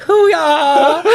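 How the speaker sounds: high, loud playful exclamation; mock exclamation. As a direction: shout it like a happy battle cry. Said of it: "I'm putting that in my lexicon".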